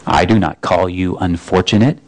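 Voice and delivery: a vast hidden voice, patient; Aslan's voice. Acted. The Voice answers Shasta.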